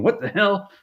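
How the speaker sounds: high mock outrage; high hammed-up tantrum. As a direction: whine it like a spoiled child. Tantrum at the lane.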